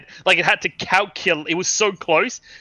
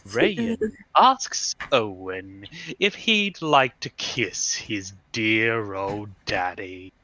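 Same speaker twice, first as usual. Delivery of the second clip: slow, low sinister villain read; 8 semitones lower, 5 dB quieter, much slower than usual. Acted. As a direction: drawl it like a creepy villain. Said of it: "your villain voice".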